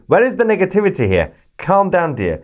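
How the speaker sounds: high, fast, mock-patronising; teasing. Real, not acted. Host teasing the viewer who ranted about negativity. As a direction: pat the critic on the head with your voice.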